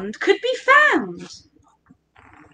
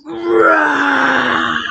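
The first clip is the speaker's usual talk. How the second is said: long, rough fire-breathing roar; drawn-out playful dragon roar. Acted. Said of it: "breathing out fire".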